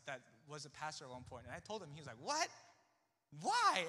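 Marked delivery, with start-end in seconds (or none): 2.2-3.9 loud, high-pitched question; shocked disbelief. Retold or imitated. Former pastor in the family hearing the news, shocked. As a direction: imitate an appalled relative, voice shooting up. Relative reacts to career news.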